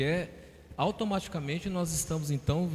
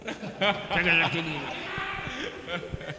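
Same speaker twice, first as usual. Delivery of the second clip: real laughter; 3 semitones higher than usual. Real, not acted. Speaker laughs at the cockroach interruption, genuinely amused. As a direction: burst out laughing after a startle.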